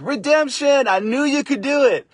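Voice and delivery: high, loud mock victory cry; triumphant cheer. Cheering the stylist on after her redo, high and loud. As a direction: cheer her on like she just won.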